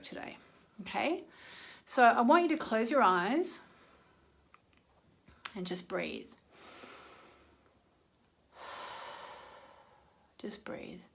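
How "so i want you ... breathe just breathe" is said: soft, hushed breathing guidance; guided meditation. Acted. Leading viewers through dark moon release breathing, hushed meditation voice. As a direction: guide them gently through the breaths, partly whispered.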